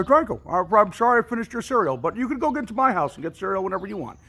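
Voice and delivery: high, loud, gushing thanks and sorry; bubbly neighbour owning up to eating your cereal; eager apology. Retold or imitated. Neighbour thanking for the cereal, high and gushing.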